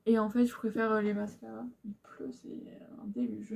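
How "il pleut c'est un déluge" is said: whisper. Real, not acted. Aside about heavy rain.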